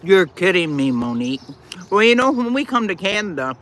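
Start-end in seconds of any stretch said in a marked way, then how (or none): 1.9-3.6 talking while chewing; words round a mouthful; mouth full. Real, not acted. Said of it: "Don't talk with your mouth full".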